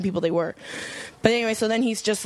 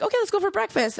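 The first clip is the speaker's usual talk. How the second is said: higher, lightly voiced breezy offer; mock casual invitation; 10 semitones higher, faster than usual. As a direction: imitate their offhand cheer, a hint only. Rapists casually suggest breakfast.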